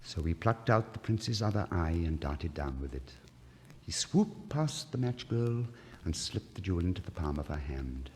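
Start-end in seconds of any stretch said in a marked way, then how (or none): none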